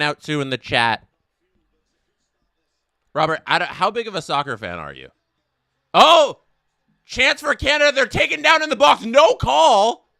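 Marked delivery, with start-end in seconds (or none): 5.9-10.1 sudden loud high shout of a chance; excited call. Real, not acted. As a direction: jump up the voice as the chance comes. Big chance for Canada, excited call.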